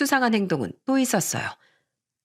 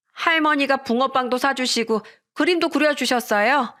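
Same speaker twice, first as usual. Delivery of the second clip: put-on higher, slowed child voice greeting dad; little boy voice; 6 semitones higher than usual. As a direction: play the small son running to dad. Son tells about grandma.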